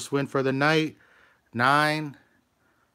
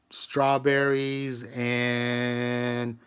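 burping; much slower than usual. Real, not acted. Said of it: "I'm burping".

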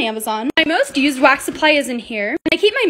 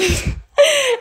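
giddy laugh; 10 semitones higher than usual. Real, not acted. Tired laugh at 3 am.

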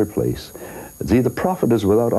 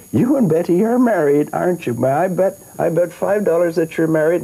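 put-on higher, eager old lady; chatty old lady making her bet, higher; little old lady voice; 10 semitones higher than usual.

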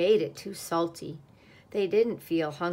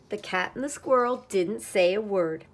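ordinary delivery

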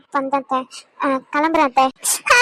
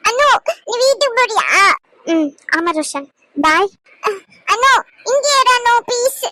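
put-on higher little boy, frightened then begging; scared pleading child; 6 semitones higher than usual. Grandson begs Anu not to leave, high pleading child voice. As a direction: plead like a small boy afraid of a smack.

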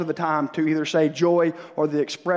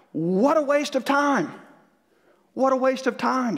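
impassioned preaching; 7 semitones higher than usual. Acted. Preaching emphasis.

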